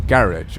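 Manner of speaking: "garage" stressed on the first syllable, GARR-idge; "garage" with short ending in a j sound; alternative pronunciation. Shown as a demonstration. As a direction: say "garage" to rhyme roughly with carriage. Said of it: "how do you want to pronounce it".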